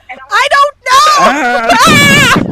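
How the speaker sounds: a cornered player screaming her answer; panicked shout. Retold or imitated. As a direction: yell it in helpless panic.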